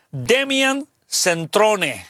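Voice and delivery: very slow, careful name reading; spelling it out. Shown as a demonstration. Host giving listeners the author's name, slowly sounded out. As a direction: sound out "Demian Centrone" slowly. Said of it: "lo estoy deletreando".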